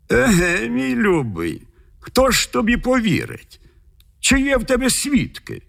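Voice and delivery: high-pitched judge's voice, doubting; sceptical judge. A narrator's reading. The judge asks Michal for witnesses, sceptical. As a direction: question him in a high, sceptical voice.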